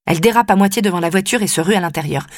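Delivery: ordinary delivery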